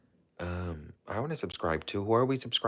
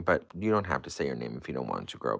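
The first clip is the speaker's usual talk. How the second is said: ordinary delivery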